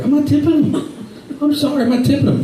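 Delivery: high, indignant refusal; mock outrage at tipping. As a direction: refuse flatly, high and put out.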